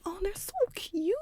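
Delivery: high, soft gushing voice; a light gushing aside. Retold or imitated. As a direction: coo over the couple's pictures. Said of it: "I'm like".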